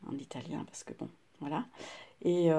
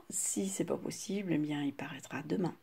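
whispering; 2 semitones lower, 5 dB quieter than usual. Real, not acted. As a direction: whisper "Si c'est pas possible" as an aside. Weighs whether the video can be posted.

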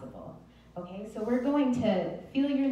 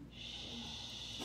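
soft unvoiced shh, all the air out; long whispered shush; 14 dB quieter than usual. Shown as a demonstration. Teacher showing the class the release, a soft whispered shh. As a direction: let the breath go on a gentle shush. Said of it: "let all of your air out on a".

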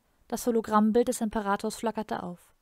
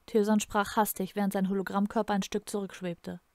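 usual speaking voice

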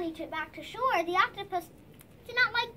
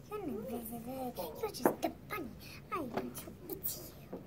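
creepy creature; 2 semitones lower, 11 dB quieter than usual. Acted. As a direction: murmur like a lurking beast. Quiet low monster voice.

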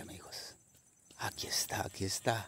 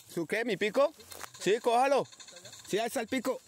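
high-pitched call to a companion; raised voice, carrying to someone at a distance; 10 semitones higher than usual. Real, not acted. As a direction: call it across to your partner, pitched up. Calling to companion off camera.

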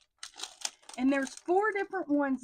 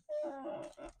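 short high laugh, far higher up; giddy laugh; 7 dB quieter than usual. Real, not acted. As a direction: let a squealing giggle slip out. Giddy over stickers.

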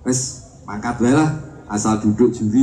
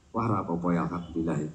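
hushed aside; 8 semitones lower, 12 dB quieter than usual. Retold or imitated. Flustered judge's quiet aside.

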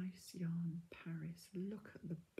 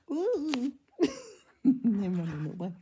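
excited squeal; 5 semitones higher, 14 dB louder than usual. Real, not acted. Yarn balls rolling off, squealing.

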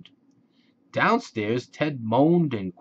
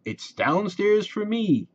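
high happy call; 10 semitones higher than usual. A narrator's reading. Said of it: "yelled Fred".

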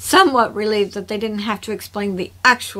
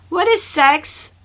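lightly voiced curious child, higher and slow; child's question; 6 semitones higher, 3 dB louder, slower than usual. A narrator's reading. Kilty asks the adults what a word means, innocently curious. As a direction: ask it slowly, higher, like a puzzled little one.

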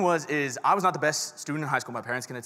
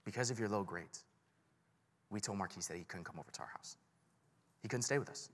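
parents breaking bad news, much softer; hushed, heavy retelling of the verdict; quiet, grave parents; 5 semitones lower, 13 dB quieter than usual. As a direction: parents telling their son the news, softly and gravely. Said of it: "my parents are like".

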